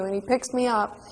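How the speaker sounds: usual speaking voice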